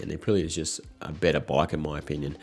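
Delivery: usual speaking voice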